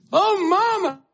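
high, pleading acted cry; young man's desperate scream, acted; screaming for mama. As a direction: cry out for your mother's help. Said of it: "screaming for his mama".

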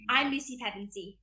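mimicked British accent of a Narnia heroine. Shown as a demonstration. Young actor mimicking a favorite film character, British accent. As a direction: introduce yourself in a British accent. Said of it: "and then British accent".

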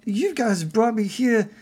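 high-pitched wide-eyed pleading; earnest Harry character. Acted.

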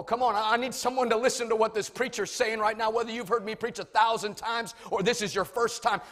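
ordinary talk, nothing marked

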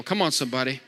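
ordinary talk, nothing marked

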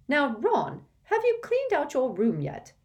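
high, loud motherly nag; nagging mother. Acted. Mrs Weasley asks Ron about his room, high nagging mother. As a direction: check up on him like a busy mum.

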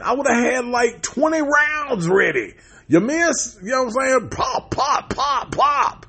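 high-pitched disbelieving rant, words stretched; exasperated rant. Real, not acted. Movie fan ranting about the single bullet, exasperated. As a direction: rant in a high, incredulous shout-like squeal. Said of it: "moving off the rant".

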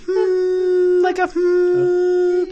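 high, long steady hum. Shown as a demonstration. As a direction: hold a level hum. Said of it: "Give me more of a steady".

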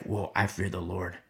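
low, soft, awed whisper-like aside; a spooked believer, low and hushed. Acted. Awed reaction to the stoning.

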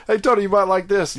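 high, loud sing-song offer; mock sweet pitch to a friend; teasing. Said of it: "It's like".

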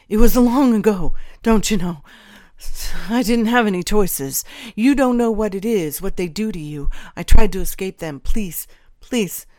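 loud, pleading traitor's voice; desperate pleading. A narrator's reading. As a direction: plead as a man cornered. Traitor pleading for his life.

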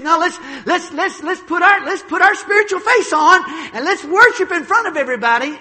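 high, loud, put-on pious voice; mocking Saul's piety. Preacher mocking Saul faking spirituality before the crowd, high and loud. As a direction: ham up a showy pious invitation.

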